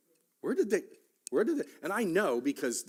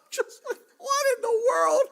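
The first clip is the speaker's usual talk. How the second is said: a much higher, louder shout through laughter; laughing shout; 10 dB louder than usual. Real, not acted. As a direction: laugh out the disbelief at full volume. Retelling wife's squash offer.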